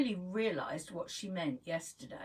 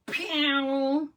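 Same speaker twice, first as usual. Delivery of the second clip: whooshy pew sound effect; 7 semitones higher, 8 dB louder than usual. Acted. Lashes lengthening sound effect.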